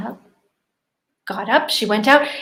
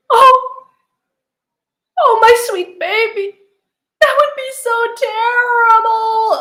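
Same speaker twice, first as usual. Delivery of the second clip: high, louder weeping mother; tearful lament; 9 semitones higher, 7 dB louder than usual. Acted. Mother comforting daughter while weeping, high and loud. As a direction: cry along, voice breaking high.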